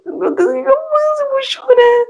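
high, panicky near-tears whine; rising panic about to cry; mock panic. Girl panicking as her bus ticket falls.

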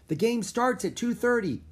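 put-on high-pitched kid calling out; urgent high holler; small girl's yell. A narrator's reading. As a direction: yell the news like a little girl. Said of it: "yelled a small girl".

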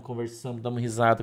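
ordinary talk

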